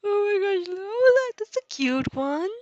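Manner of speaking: high, squealing delight at cute art; excited squeal. Real, not acted. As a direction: gush over the drawing.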